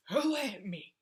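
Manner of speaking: loud, high outraged shout; angry yell. Retold or imitated. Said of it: "It was just me yelling".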